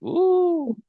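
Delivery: high, loud "ooh"; imitated "ooh". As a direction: let out a long, high-pitched ooh. Imitates the numbers starting to move.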